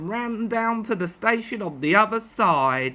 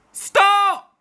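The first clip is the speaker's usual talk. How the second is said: sharp high yell to stop the train; 10 semitones higher, 6 dB louder than usual. Acted. Fieldsman yelling after the departing train, loud high yell. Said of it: "yelled the fieldsman".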